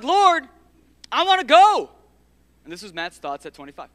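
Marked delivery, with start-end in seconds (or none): 0.0-1.9 high, loud plea to the Lord; pleading cry. Retold or imitated. Young pastor begging God to let him leave, loud plea. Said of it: "And I'm like".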